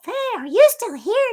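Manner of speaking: high, loud goofy character voice; funny voice. Shown as a demonstration. Host showing off funny voices once used on daughter, goofy voice. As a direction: pitch it way up and get silly. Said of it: "I used to make these funny voices".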